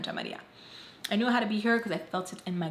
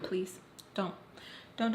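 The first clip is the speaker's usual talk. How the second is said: ordinary talk, nothing marked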